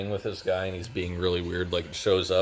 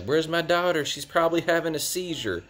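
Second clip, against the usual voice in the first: worried mom voice; 10 semitones higher than usual. Retold or imitated. Mom voiced in retold scene.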